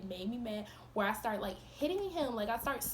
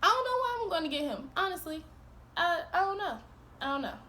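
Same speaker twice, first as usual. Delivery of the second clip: exasperated; 9 semitones higher, 4 dB louder than usual. Retold or imitated. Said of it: "I was like".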